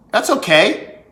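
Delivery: usual speaking voice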